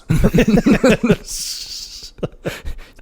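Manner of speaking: high wheezy laughter; high breathy laugh. Real, not acted. Cracking up at a movie quote, high laugh.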